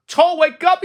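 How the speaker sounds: high, loud wake-up call; cried out. A narrator's reading. Frog calling loudly at Toad's door to wake him. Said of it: "he cried".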